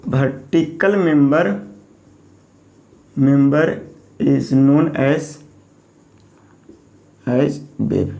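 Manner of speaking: very slow, each word stretched; teacher dictating a definition word by word; dictation pace. Shown as a demonstration. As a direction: draw out every word as if dictating. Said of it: "vertical member को बोलेंगे".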